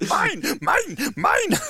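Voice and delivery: high-pitched mock yell of a possessive little creature; imitated squeaky yelp, each word on its own; Yoda yelling. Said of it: "he's yelling, "Mine".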